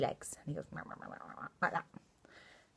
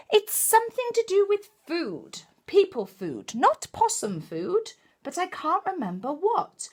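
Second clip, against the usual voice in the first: excited higher exclaiming grandma; mild shout; 12 semitones higher, 6 dB louder than usual. Acted. Grandma Poss calling out her idea to Hush, excitedly. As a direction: announce the discovery. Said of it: "she shouted".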